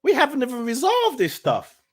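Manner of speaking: high, loud disbelief; incredulous inner reaction. Retold or imitated. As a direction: echo the suggestion as if absurd. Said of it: "In my head, I'm like".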